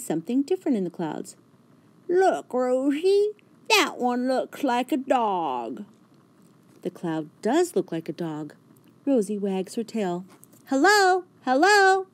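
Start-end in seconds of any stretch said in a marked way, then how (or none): 2.1-5.8 slow, higher, excited pointing; George's voice. A narrator's reading. George shows Rosie the dog cloud, slow and excited. 10.7-12.1 loud high hello called to the sky; shouting. A narrator's reading. Rosie shouts hello up to the dog cloud. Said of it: "she shouts".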